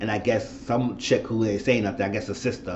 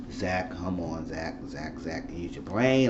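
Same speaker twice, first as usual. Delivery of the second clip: soft pleading; 6 semitones lower, 7 dB quieter, slower than usual. Retold or imitated. Pleading with Zach on screen.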